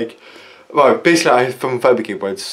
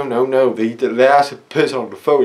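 ordinary talk, nothing marked

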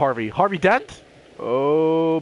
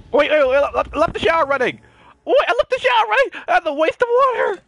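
high, loud panicked yelp; 14 semitones higher, 6 dB louder than usual. Real, not acted. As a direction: shriek in sudden panic. Panicked about running shower.

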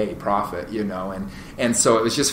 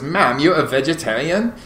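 put-on higher, incredulous lightly Dutch-accented "vegetarian?" with rising tune; funny Dutch accent; 6 semitones higher than usual. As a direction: lightly imitate a Dutch salesman in disbelief. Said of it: "he just has this funny accent".

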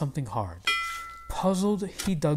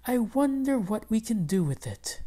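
wife's voice; 8 semitones higher than usual. Acted. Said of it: "said Missus Haktak".

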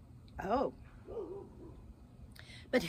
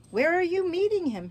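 eager owl; 9 semitones higher, 7 dB louder, faster than usual. Acted. Owl asks nervously.

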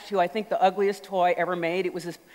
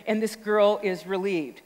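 usual speaking voice

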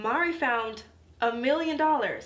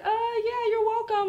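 awkward high reply; 11 semitones higher than usual. Retold or imitated. Mari grabbed by the girl who was mean, high awkward reply. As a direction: squeak out an awkward you're welcome.

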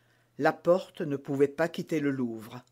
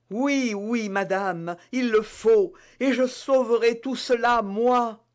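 higher, eager, proud promise; confident maid; 6 semitones higher, 4 dB louder than usual. Acted. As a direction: vow to save everything. Bonacieux promet de sauver. (servante confiante, voix aiguë)

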